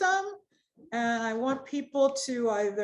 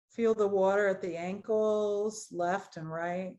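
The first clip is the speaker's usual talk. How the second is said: guided meditation. Acted. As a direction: ease them gently into the body scan. Leads a guided body scan.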